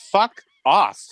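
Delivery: sharp retort to mom; retold fuck off. Son snapping at his mother, fuck off. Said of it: "I go".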